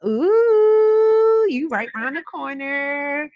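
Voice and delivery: high squeal, then delighted; thrilled to hear it; excited. Real, not acted. Delighted at caller's city.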